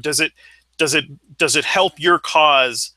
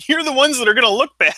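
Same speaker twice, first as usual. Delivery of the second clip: laughing; 11 semitones higher, 4 dB louder, faster than usual. Retold or imitated. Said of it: "I was like".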